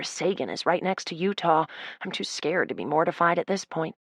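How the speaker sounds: ordinary delivery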